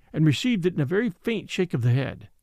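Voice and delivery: usual speaking voice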